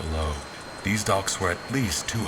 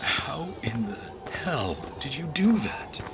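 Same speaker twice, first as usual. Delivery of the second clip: astonished; 9 semitones higher than usual. Acted. Donnie astonished by Hazel's trick.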